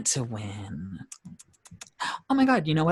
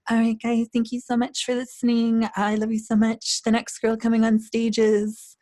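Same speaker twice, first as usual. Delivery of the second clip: put-on sweet little baby voice; 11 semitones higher, 4 dB louder than usual. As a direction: imitate a sweet little pop-star announcer. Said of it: "she had this sweet little baby voice".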